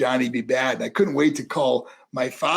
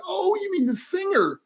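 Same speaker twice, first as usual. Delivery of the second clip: sudden realization. Retold or imitated. Rookie finally catching on to the mentor, surprised. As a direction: retell it with a mock dawning surprise.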